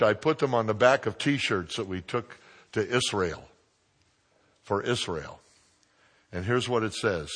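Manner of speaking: ordinary talk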